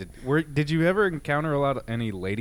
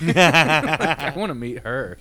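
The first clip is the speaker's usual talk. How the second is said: laughing; 7 dB louder, slower than usual. Real, not acted. Laughing admiration of bear.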